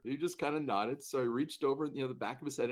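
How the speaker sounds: usual speaking voice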